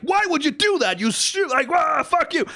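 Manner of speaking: hammed-up fury at the show, breaking off mid-insult; put-on angry voice. Fan yelling at the anime for trying too hard, put-on angry shout. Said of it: "I'm putting on a sort of angry voice".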